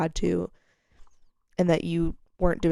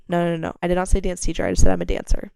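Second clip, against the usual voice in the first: louder, fast insistent correction; emphatic correction; 7 dB louder, faster than usual. Real, not acted. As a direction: correct yourself firmly, rushing. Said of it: "or no, no".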